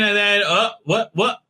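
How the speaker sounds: normal talking voice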